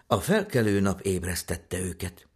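usual speaking voice

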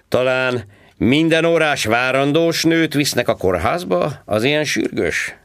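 high, loud mocking cop voice; sarcastic policeman. A narrator's reading. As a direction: needle him like a smug traffic cop. Policeman questioning the driver. (gúnyos rendőrhang, magasan és hangosan)

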